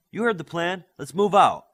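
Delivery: loud, high command to move out; rallying call. Acted. Ranger orders the assembled Alphas out, loud rallying shout. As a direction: rouse the troops with a shout.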